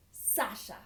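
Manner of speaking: ordinary delivery, nothing marked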